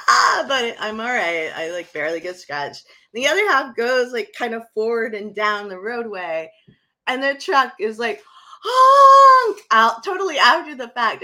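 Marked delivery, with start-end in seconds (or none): 0.1-0.4 high, loud startled "Ah"; a high, loud frightened yelp; retold scream. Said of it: "And I'm just like". 8.6-10.0 high, loud honking noise for the truck; a high, loud imitated honk; mock horn honk. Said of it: "he panic honked".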